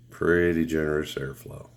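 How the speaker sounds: normal talking voice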